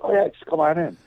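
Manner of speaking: high easygoing imitation; casual nurse. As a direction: wave him in like it's nothing. Nurse voiced in story.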